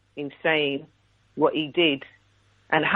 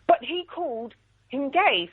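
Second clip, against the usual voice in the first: higher-pitched mock protest; protesting teacher; 9 semitones higher than usual. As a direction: lightly mimic an objecting teacher in a higher voice. Retold teacher objecting to parent.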